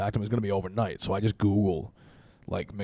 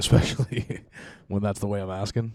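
ordinary talk, nothing marked

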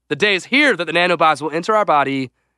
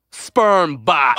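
dramatic reveal. Real, not acted. Big reveal of the topic.

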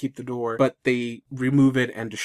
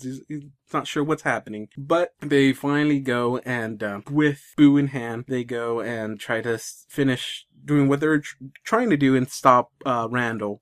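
ordinary talk, nothing marked

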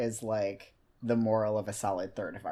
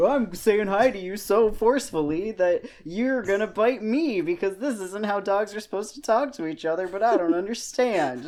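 much higher and louder, a clueless friendly dog; over-eager dog voice; 9 semitones higher, 10 dB louder than usual. Acted. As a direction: voice the dog babbling that it doesn't get why others bite. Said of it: "He'll just be like".

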